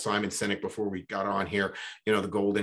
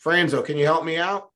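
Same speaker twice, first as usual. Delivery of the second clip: louder, higher mock call to a friend; retold yell for help, higher and louder; 6 semitones higher, 6 dB louder than usual. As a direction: holler up to a buddy passing by the hole. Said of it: "He yells up".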